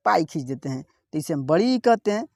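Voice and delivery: ordinary talk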